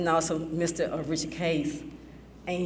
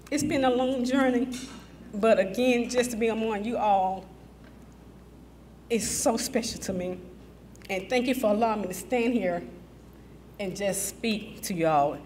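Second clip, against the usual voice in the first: shaky sigh; 4 semitones higher than usual. Real, not acted. Emotional thanks after "trying not to cry.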